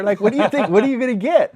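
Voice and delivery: put-on high, loud taunting kid voice; mocking kids. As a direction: taunt him like a schoolyard kid. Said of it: "kids are start laughing at me".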